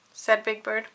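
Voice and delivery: ordinary delivery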